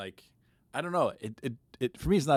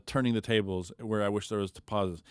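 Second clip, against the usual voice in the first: normal talking voice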